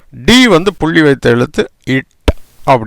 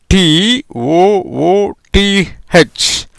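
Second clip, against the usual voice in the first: slow spelling out; 3 semitones higher, much slower than usual. Shown as a demonstration. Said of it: "spelling out the word".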